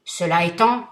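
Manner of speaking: thundering vengeful genie; terrible genie voice. Acted. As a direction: roar it down at him like a giant. Genie speaks.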